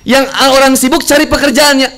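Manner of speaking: ordinary delivery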